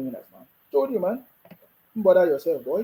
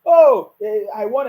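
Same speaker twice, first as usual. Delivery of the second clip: loud, mocking boast; mocking quote; 3 semitones higher, 8 dB louder, slower than usual. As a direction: mimic a boastful politician. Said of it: "that thing that Obi said".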